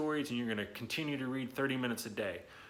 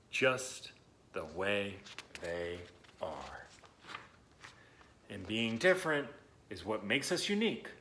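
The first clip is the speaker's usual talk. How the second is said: put-on reading to children. Teacher reading a picture book to young listeners, gently. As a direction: read it to the kids, letting lines settle.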